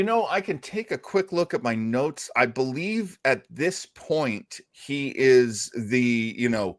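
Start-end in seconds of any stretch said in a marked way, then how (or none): none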